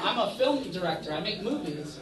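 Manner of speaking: ordinary talk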